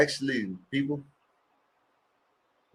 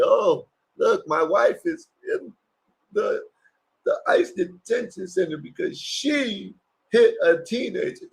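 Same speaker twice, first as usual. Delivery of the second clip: mocking whine; 10 semitones higher than usual. Mocking the husband's complaint.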